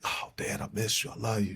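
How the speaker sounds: barely holding back tears, voice cracking up; tearful plea. Retold or imitated. Son on the phone with his long-lost father, softly breaking down.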